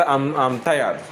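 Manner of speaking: ordinary delivery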